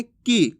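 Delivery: की cut short, brief "i"; short vowel. Shown as a demonstration. Teacher modelling the short i matra, brief vowel. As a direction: say की quickly with a short i. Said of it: "इसका उच्चारण में कम समय लगता".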